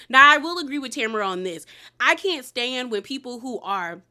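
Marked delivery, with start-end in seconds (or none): none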